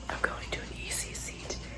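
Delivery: hushed morning whisper; quick whispered update. Real, not acted. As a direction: whisper so no one wakes.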